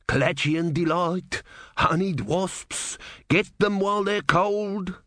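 slow hopeful hawker; vendor hawking. A narrator's reading. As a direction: hawk it to the crowd. Tray man hawking.

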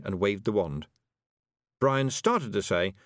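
usual speaking voice

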